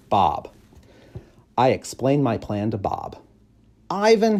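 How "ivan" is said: high, loud eager bark of a name; a small dog calling out his friend; little stray dog voice, louder and higher; scrappy little dog. Acted.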